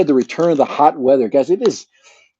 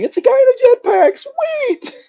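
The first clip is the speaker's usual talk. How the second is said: shrieking high, cracking with excitement; excited shout. Retold or imitated. Everybody screaming about the jetpack, high and thrilled. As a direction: scream it like the best thing ever. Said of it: "everybody else is going".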